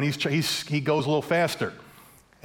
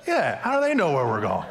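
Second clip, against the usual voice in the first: laughing agreement. Retold or imitated. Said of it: "He's like".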